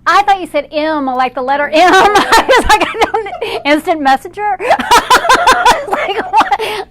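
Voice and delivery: high, loud, laughing through the words; helpless real laughter over a silly mishearing; cracking up. Real, not acted. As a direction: barely get it out for laughing.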